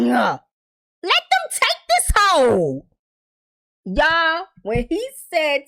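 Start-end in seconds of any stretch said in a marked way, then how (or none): 0.0-2.8 exasperated urging. Real, not acted. Urging Victor at screen.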